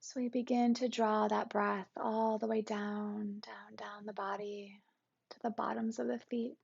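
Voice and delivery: calm, even meditation guide with long pauses; guided meditation. Acted. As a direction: guide listeners gently into the body, unhurried and settled.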